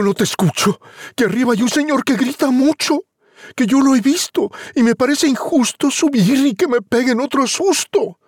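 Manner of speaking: put-on high, fast frightened boy; scared boy. Juan frightened, complaining to his mother about the giant. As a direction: babble in fright.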